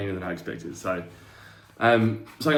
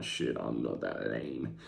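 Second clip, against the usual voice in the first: low, slow aside to himself; deflated self-talk; 11 semitones lower, 8 dB quieter, much slower than usual. Retold or imitated. Athlete sizing himself up against leaner dudes, deflated. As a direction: drop it low and slow, like a sobering realisation.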